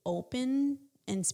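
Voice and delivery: ordinary talk, nothing marked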